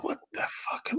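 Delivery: hushed retold disbelief; stunned whisper. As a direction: barely voice it, as if stunned. Retelling stunned reaction to news.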